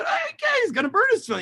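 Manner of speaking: high-pitched mock protest; alarmed objection. Rabbis objecting that he'll burn his tefillin, high mock protest.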